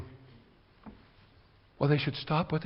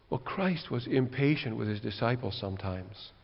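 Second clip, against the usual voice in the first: usual speaking voice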